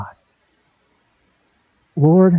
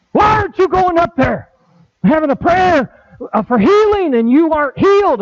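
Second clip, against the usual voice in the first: mock worried churchgoer; 12 semitones higher than usual. Religious people questioning him.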